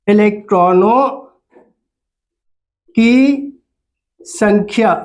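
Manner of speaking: "इलेक्ट्रॉनों … की … संख्या" with long gaps between words; word-by-word dictation. Shown as a demonstration. Teacher dictating "इलेक्ट्रॉनों की संख्या" for pupils to write, long pauses between words.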